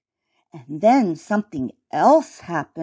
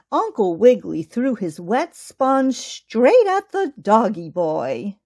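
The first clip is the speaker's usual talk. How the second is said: ordinary delivery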